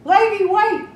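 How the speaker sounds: high, loud calling after her; pleading call. A narrator's reading. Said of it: "The prince kept pleading".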